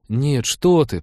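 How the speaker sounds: lightly hurried denial; hasty objection. A narrator's reading. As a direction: object at once, mildly alarmed. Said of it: "поспешно возразила Трикси".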